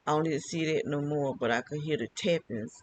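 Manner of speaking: ordinary delivery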